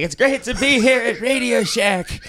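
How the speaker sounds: high showman's stage call. Retold or imitated. Comic as the singer greeting the wrong venue, showman call. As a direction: call it out like a confused old crooner. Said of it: "[name]'s like".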